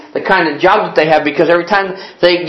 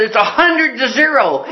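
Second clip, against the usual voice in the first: put-on higher, cheerful kid's report; untroubled boy, pitched up; 7 semitones higher than usual. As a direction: lightly imitate an upbeat little ballplayer. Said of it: "the boy was so cheerful and encouraging".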